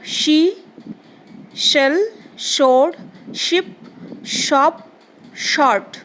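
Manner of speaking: each of शी, शेल, शोर begun with a clear hushing SH; SH sound demonstration. Shown as a demonstration. Teacher showing pupils how to read SH words, शी to शॉर्ट each with a distinct SH. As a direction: model the SH in शी through शॉर्ट, word by word. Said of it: "और एक बार पढ़ रही हूँ".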